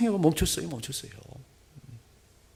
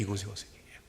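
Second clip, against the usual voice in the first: hushed whisper; 5 semitones lower, 12 dB quieter, faster than usual. Acted. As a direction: drop to a soft, breathy whisper. Pastor whispers invitation to altar.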